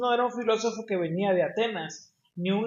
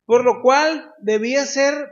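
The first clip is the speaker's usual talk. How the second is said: higher, louder and slow pulpit reading; raised preaching voice, slowed for weight; emphatic proclamation; 6 semitones higher, 8 dB louder than usual. Acted. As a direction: announce the verse with force. Reads Hebrews verse emphatically.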